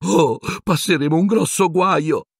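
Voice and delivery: high-pitched panicked wail; whimpering terror. Acted. Girl fearing big trouble, panicked whimper. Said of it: "con occhi pieni di terrore".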